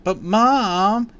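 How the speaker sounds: put-on high, loud childish whine; whiny protest. A narrator's reading. Girl protesting to her mom, high whine. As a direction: plead unfairly accused.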